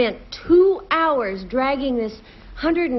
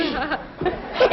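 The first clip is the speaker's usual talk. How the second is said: real laugh; 3 semitones higher than usual. Real, not acted. Contestant laughing at her sewer cover story, amused. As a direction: laugh at your own story.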